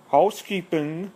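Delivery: high-pitched mock German accent; imitating a film character's German accent; fake German accent. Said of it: "like, this fake German accent".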